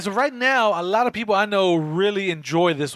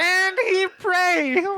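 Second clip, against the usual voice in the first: dramatic quote; 11 semitones higher, much slower than usual. Retold or imitated. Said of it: "That's what I'm like".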